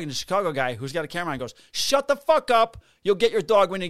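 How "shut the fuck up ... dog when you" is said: retold angry shout. Chicago officer silencing the dog owner, retold angry shout. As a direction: shout her down, imitating the officer.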